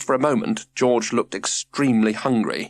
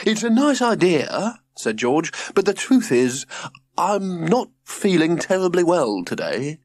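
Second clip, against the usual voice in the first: prim father voice; 7 semitones higher than usual. Acted. George voiced.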